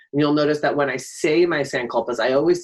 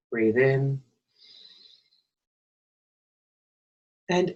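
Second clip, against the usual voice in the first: guided meditation. Acted. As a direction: guide them gently into stillness, phrase by phrase. Leads a breathing meditation.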